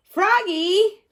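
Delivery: high, loud call across the house. A narrator's reading. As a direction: call Froggy from the other room. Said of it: "called his dad".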